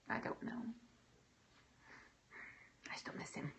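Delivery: whispered, voice dropping away on "I don't know"; tearful whisper. Real, not acted. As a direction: let it slip out in a breath, grieving. Grief over lost father.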